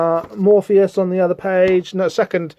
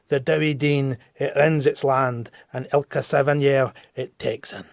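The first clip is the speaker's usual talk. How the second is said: Scottish accent; 3 semitones lower, 5 dB quieter than usual. Shown as a demonstration. Reading the cursed river's rhyme, Scottish accent. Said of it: "I'm gonna do a Scottish accent".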